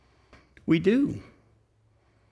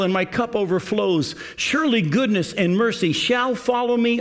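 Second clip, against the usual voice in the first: higher, fervent reading of the psalm; rising scripture reading; 4 semitones higher than usual. Acted. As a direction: lift the voice as the psalm turns to you. Reads the psalm, voice lifted.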